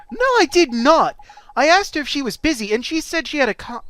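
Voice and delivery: put-on loud, high kid voice; emphatic cartoon denial; indignant kid Oliver. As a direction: protest like an eager youngster.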